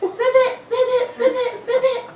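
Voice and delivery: very high, squeaky little frog; high-pitched puppet frog; Baby Ribbit. Pretend. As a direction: croak ribbit in a tiny high voice. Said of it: "Baby Ribbit".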